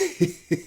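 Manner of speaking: acted tittering laugh; tickled laugh. Elephant tickled by rabbit's boast, acted tittering laugh. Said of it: "this tickled Brer Elephant".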